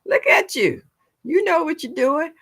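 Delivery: high, singsong cheering on; high-pitched retold praise; delighted praise. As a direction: imitate her gushing in a high, lifted voice. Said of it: "then she came back and said".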